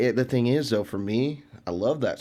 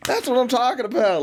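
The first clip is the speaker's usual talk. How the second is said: excited cheer; 12 semitones higher, 4 dB louder than usual. Retold or imitated. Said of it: "I'm getting all excited".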